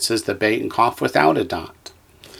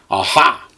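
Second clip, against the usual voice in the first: aha with the HA hit hard; accent the final syllable of aha; "aha", light first syllable, strong "ha"; stress on last syllable; 5 semitones higher, 4 dB louder than usual. Shown as a demonstration. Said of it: "you accent the last syllable".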